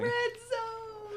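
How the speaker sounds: high-pitched drawn-out mock alarm; mock alarm cry. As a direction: call it out high, like a hammed-up emergency. Shouts a catchphrase in jest.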